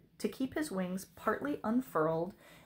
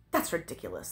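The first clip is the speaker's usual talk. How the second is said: low, dismissive dragon; disdainful sniff; 6 semitones lower, faster than usual. A narrator's reading. Dragon scoffing at the princess's excuse, sniffy. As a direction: dismiss it with lowered pitch and disdain. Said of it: "the dragon sniffed".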